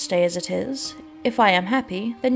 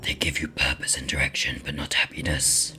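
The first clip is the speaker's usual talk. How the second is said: deep raspy demon whisper; 5 dB quieter than usual. Acted. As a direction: hiss it like a lurking demon.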